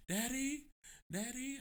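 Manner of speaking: put-on high little-kid voice calling Daddy; imitating toddler. Dad mimics his toddler son on phone calls, high and childlike. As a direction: pipe up like a small boy on the phone.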